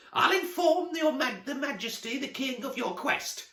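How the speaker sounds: very high witch's croak; croaking Baba Yaga. Acted. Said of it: "croaked Baba Yaga".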